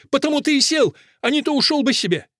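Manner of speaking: high, accusing outcry; Fusia. A narrator's reading. Fusia reproaches Akelina for leaving, crying out high. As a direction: push it out high and hurried at the end. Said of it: "кричит Фуся".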